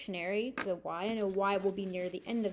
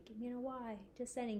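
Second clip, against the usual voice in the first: quiet echo; 10 dB quieter than usual. Shown as a demonstration. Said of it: "you repeat".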